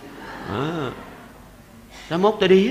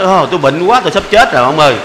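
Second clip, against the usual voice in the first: louder hammed-up moaning about being ill; mock sick complaint; 8 dB louder than usual. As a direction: overplay the sick old man for laughs. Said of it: "nói".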